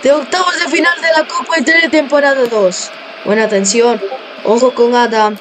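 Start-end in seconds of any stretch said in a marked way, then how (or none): 0.0-2.9 high shouted play-by-play; excited goal call. Acted. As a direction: scream the equaliser to the crowd. Equaliser, commentator shouting.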